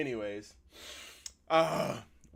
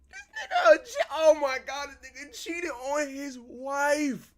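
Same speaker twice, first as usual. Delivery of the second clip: high, loud squeal of disbelief; shocked outburst; 13 semitones higher, 9 dB louder, slower than usual. Real, not acted. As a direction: shriek it in scandalised shock, stretching the end. Shocked at cheating in the story.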